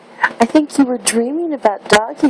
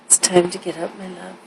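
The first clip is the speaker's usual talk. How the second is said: sweet nothings; 5 semitones lower, 5 dB quieter, faster than usual. Real, not acted. Mother softly waking baby.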